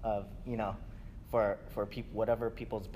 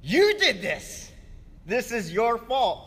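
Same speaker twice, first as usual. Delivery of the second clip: much higher, louder, slow accusing cry; Sarah blaming Abram; 11 semitones higher, 9 dB louder, slower than usual. Retold or imitated. Sarah turns on Abram, shrill and loud with blame. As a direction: hurl the blame at him. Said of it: "turns to Abram and says".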